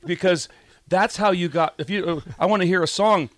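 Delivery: ordinary delivery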